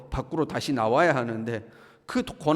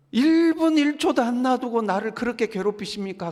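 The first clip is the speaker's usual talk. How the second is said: acted high-pitched indignant plea; aggrieved protest; 7 semitones higher, 4 dB louder than usual. Job protesting to God about constant torment, aggrieved protest. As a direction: protest it high, like one given no respite.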